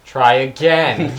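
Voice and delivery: slow, low deadpan try again; lightly voiced slow dad. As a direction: drag it out dryly. Slow mock quote.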